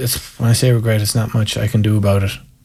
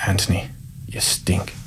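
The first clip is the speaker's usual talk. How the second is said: quiet, breath-only finish, imitating the coach; hushed disgusted reply; 2 semitones lower, 9 dB quieter, slower than usual. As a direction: drop to a near-whisper for the put-down.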